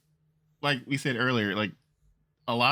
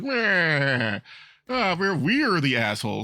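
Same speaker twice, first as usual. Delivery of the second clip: smug cartoon villain, a bit higher; mustache twirling villain; 5 semitones higher, slower than usual. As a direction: sneer it like a hammy villain. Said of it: "Like the evil guy twirling his mustache".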